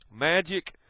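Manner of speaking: ordinary talk, nothing marked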